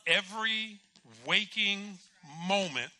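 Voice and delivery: EVERY, WAKING, MOMENT each punched loudly; loud, with a pause after each of "Every waking moment"; emphatic repetition. Retold or imitated. Patient reporting his constant confession, "Every waking moment" hammered word by word.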